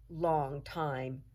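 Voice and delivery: ordinary delivery, nothing marked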